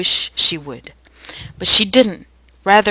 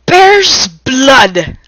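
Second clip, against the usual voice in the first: loud hammy line; 6 semitones higher, 9 dB louder than usual. Acted. Hammy reread of Gulick's line.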